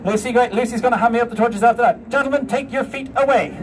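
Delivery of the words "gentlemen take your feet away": usual speaking voice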